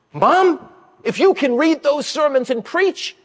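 put-on a light high boy voice; a hint of a pleading kid; eager boy. Boy persuading his mom, lightly high and eager.

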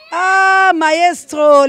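high, loud, long-held call; hailing shout. Real, not acted. Host hailing the maestro, hailing shout. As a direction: stretch the greeting out at full voice.

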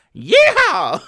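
loud, high Southern holler; over-the-top hillbilly whoop; mock Southern whoop. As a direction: let out a yee-haw yell, Southern style.

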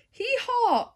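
high mock hee haw bray; playful donkey hee haw. Donkey bray in read-aloud book.

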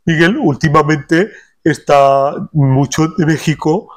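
slow, drawn-out [name] voice with Mexican lilt; lightly voiced singer [name]; [name] impression. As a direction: ease into his Mexican-flavoured drawl.

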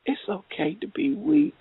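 softly voiced reassurance; kind, sweet voice. God's voice telling her weakness is okay, kind and sweet. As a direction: say it very softly, as comfort. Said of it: "that voice was so kind, so sweet".

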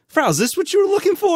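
very high, quick imitation of a classmate; mock-innocent quote. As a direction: squeak it out fast, as a teasing question. Acting classmate taunting the teacher.

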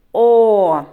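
loud, drawn-out "о"; stressed vowel. Shown as a demonstration. Teacher naming the stressed sound, loud long "о". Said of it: "На звук".

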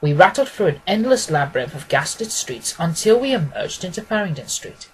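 plain reading voice

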